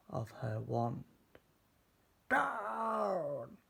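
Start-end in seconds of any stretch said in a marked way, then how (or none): 2.3-3.6 a witch's sharp call from the sky; witch crying out. Acted. Witch ordering her broom down, high cry. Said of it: "cried the witch".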